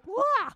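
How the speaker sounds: high, comic "wah"; mock wail. Reacting to writing her own book, mock wail. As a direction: let out a quick high mock cry.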